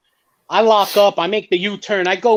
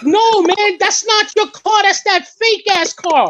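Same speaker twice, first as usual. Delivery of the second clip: excited correction; 9 semitones higher, 3 dB louder, slower than usual. Retold or imitated. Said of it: "And they were like".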